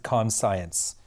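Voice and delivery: "conscience" said as "con-science"; jokey mispronunciation. Shown as a demonstration. Co-host playfully mispronounces a crossword answer, "conscience" as con-science. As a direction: split "conscience" into "con" plus the word "science". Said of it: "as I like to pronounce it".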